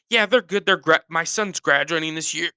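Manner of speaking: high, loud strained answer ending in a grunt; grunt at sentence end. Acted. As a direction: act pained while giving good news. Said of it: "there's always, like, a grunt".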